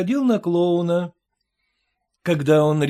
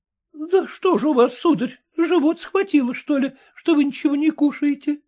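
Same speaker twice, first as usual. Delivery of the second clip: заботливая мамка; 8 semitones higher than usual. A narrator's reading. Няня спрашивает барина.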